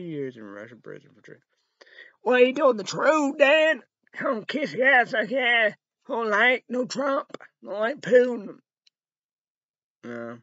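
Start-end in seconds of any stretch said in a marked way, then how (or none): 2.2-8.6 high, loud angry rant; shouted rant, much higher and louder; furious shouting. Real, not acted. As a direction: yell it at the TV, fed up. Ranting at Dan on screen.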